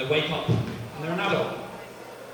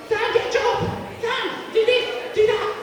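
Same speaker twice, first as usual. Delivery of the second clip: nagging mum impression; slower than usual. Comic on stage, mimicking his nagging mum in a squeaky high voice. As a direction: screech the nagging like a cartoon mum. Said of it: "She doesn't sound like that, but for comedic effect".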